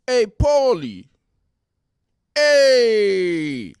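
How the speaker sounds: loud, high wise guy hey; mock Jersey greeting yell; Mike the Situation. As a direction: shout it like a Jersey tough guy. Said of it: "This is Mike the Situation and Paulie D".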